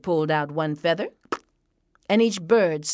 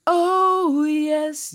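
high, loud drawn-out coo; sing-song bird delight; delighted doves; 9 semitones higher, 5 dB louder than usual. A narrator's reading. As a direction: swoop up on a long "oh". Mourning doves' cry entering garden.

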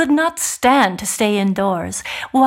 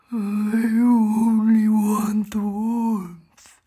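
slow, pleading talking bear; gentle bear voice; slower than usual. A narrator's reading. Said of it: "And the bear spoke".

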